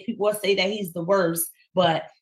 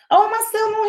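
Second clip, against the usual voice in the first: surprised; 12 semitones higher, 5 dB louder, faster than usual. Real, not acted. Host surprised mic still live.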